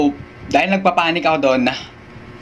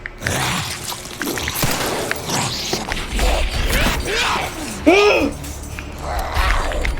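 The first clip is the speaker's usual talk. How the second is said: sharp gasp; 3 dB quieter than usual. Real, not acted. Jump scare in horror game.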